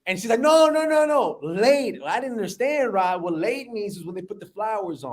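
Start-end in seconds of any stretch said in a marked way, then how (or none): 0.4-2.0 high, hurried string of denials; insistent correction. Retold or imitated. Mom correcting her son's misunderstanding, insistent. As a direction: imitate mom setting the record straight, pitched up.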